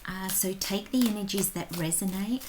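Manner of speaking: soft, intimate whisper-like tarot reading; hushed reading voice. Acted. Tarot reader opening the February reading, hushed and soft. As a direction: lean in and read the cards softly.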